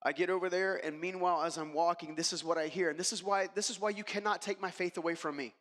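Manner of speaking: normal talking voice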